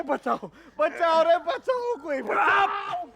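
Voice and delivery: high, drawn-out panicked cry; screaming for help. Acted. Man having a nightmare of a fight, screaming for help. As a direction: yell for rescue, stretching out the vowels. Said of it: "चिल्ला रहा हूँ".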